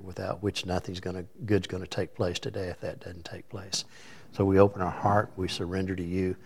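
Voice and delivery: soft, hushed praying voice; prayer. Acted. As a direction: pray quietly and earnestly. Opening prayer before sermon.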